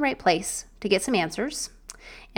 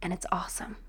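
a whispered, delighted aside; 3 semitones lower, 12 dB quieter, faster than usual. Real, not acted. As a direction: share it like a happy secret. Sharing joy of new family.

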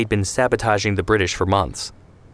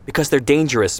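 snapped; 6 semitones higher than usual. Acted. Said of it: "Ben Shemen snapped".

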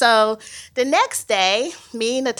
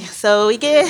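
normal talking voice

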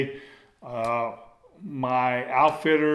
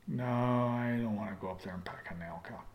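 grumbling refusal; 3 semitones lower, 10 dB quieter than usual. Retold or imitated. Rod refusing to help.

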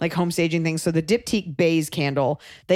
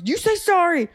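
high, heated parental order; imitated scolding; 12 semitones higher, 3 dB louder than usual. Dad ordering son to apologise, imitated scolding. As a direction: re-enact an angry dad, pitched way up.

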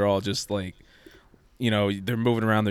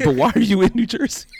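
confused mom; 13 semitones higher, 6 dB louder than usual. Retold or imitated. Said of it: "She's like".

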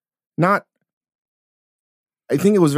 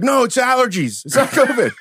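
mock defensive; 8 semitones higher than usual.

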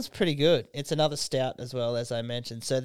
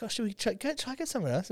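much higher, light questioning; high mock question; 8 semitones higher, 6 dB quieter, faster than usual. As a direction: float it up high. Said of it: "we're like".

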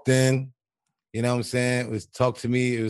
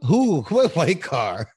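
mock denial; 5 semitones higher, 4 dB louder, much slower than usual. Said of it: "You over here like".

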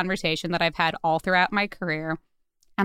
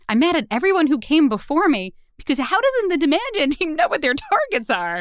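higher, heated vent about everyone before; retold frustration; 8 semitones higher than usual. Venting to the finance partner after the meeting, heated and high. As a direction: let the outrage climb as you go. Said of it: "I'm so frustrated right now".

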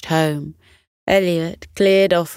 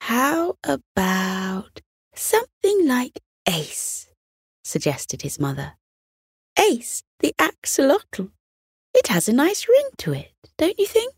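high, sing-song mother's voice; suggesting mother; 7 semitones higher than usual. Acted. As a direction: mum coaxing with a name idea.

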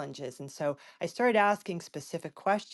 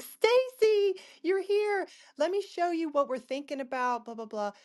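much higher, delighted welcome to Stacy; excited greeting; 13 semitones higher than usual. Retold or imitated. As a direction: greet her like an old friend, voice jumping up high. Warm greeting from the sponsor.

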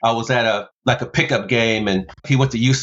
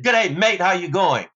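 high mock Australian greeting; a light Australian accent, higher pitched; Australian caller; 6 semitones higher than usual. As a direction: put on an Aussie phone voice, bright and high. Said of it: "the voice said".

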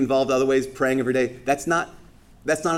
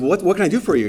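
playing dumb; 6 semitones higher, 5 dB louder, faster than usual. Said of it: "play dumb".

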